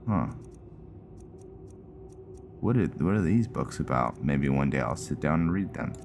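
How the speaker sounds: ordinary talk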